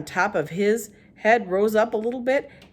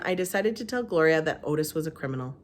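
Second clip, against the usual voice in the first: usual speaking voice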